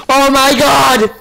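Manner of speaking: slow, loud horrified gasp; gasping horror. Real, not acted. Player finding Tasha hurt, horrified gasp.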